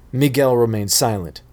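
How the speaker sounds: ordinary delivery, nothing marked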